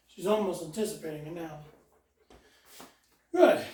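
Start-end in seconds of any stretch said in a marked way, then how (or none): 3.3-3.7 high, bright marker "Yes" then praise. Real, not acted. Trainer rewarding the dog for sitting, high and excited.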